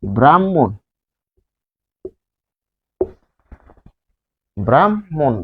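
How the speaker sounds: ব্রাহ্মণ spaced as "bram-mon"; doubled m. Shown as a demonstration. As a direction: linger on the m of ব্রাহ্মণ, h silent. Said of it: "তাহলে হবে".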